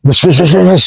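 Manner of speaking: rapid chain of "wes" bursts; repeated whooshes. Shown as a demonstration. Comparing two coloured birds, repeated imitated whooshes. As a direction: imitate the noisy bird's flapping descent. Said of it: "yang ini".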